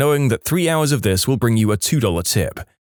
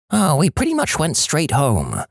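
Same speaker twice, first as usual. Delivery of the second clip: lightly voiced raspy-toned Marge; a hint of Marge Simpson, higher; Marge Simpson impression; 4 semitones higher than usual. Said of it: "my Marge Simpson impression".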